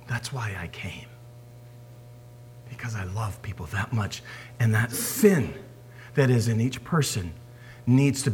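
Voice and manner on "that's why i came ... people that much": lower, hushed, unvoiced whisper. Preacher voicing Jesus to disciples, whispering.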